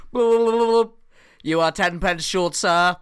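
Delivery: robot voice. Retold or imitated. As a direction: compute the change like a malfunctioning robot. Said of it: "starts talking like a robot".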